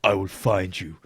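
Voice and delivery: low, menacing movie-hero growl, only half done; Liam Neeson. Retold or imitated. Mocking a Neeson Super Bowl ad, a touch of gruff menace. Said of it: "in his typical, can only act one way".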